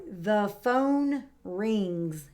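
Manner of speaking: slow, careful word by word; slow model sentence. Shown as a demonstration. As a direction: model it slowly for the listener. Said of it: "My turn".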